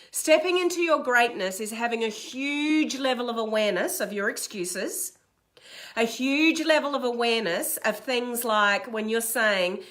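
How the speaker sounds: ordinary delivery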